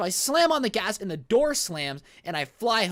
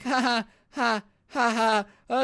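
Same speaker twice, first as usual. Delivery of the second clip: forced nervous laughter, higher; 6 semitones higher, 4 dB louder, slower than usual. Retold or imitated. Driver laughing nervously at threatening passenger. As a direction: laugh it off nervously to placate him.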